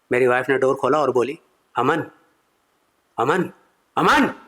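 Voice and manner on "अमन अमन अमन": wife calling out. Retold or imitated. Wife calls Aman to wake him, mock raised call. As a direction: shout the name to wake him.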